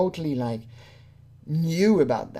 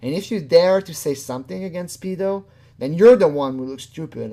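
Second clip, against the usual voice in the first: normal talking voice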